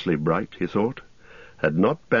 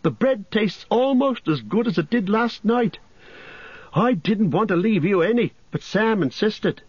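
lightly raised, teasing hobbit voice; cheeky Pippin at breakfast; 14 semitones higher than usual. A narrator's reading. Pippin teasing Frodo over breakfast, cheeky. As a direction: tease a late friend about the leftovers.

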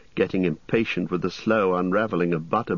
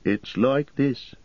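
hesitating; 8 semitones higher, much slower than usual. Acted. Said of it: "hesitating and looking troubled".

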